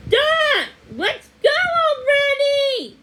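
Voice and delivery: high, loud drawn-out shout; impatient yell. A narrator's reading. Man in the car yelling at his wife, impatient. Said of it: "the man's voice said inside the".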